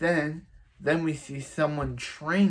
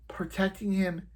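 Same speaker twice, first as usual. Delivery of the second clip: whisper; 6 semitones higher than usual. Retold or imitated. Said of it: "as someone once said".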